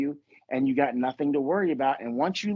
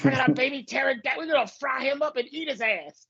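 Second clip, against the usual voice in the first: high, hyped-up boast, much higher; hammed-up excited rich guy; 9 semitones higher than usual. Retelling the sketch to a laughing friend, high excited boast. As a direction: squeal it like a giddy new millionaire. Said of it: "And he's like".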